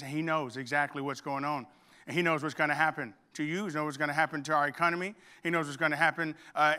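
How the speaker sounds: ordinary talk, nothing marked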